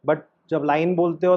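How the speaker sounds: ordinary talk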